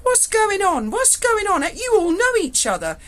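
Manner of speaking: high, loud mock bewilderment; baffled officer. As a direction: squeak out the confusion of the new officer. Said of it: "He went".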